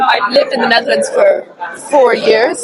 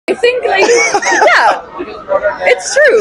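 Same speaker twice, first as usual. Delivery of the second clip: laughing; 12 semitones higher, much slower than usual. Real, not acted. Laughing while claiming to be funnier.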